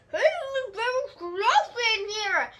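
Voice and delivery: put-on a kid thrilled with her bubble mess; real happy. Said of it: "real happy".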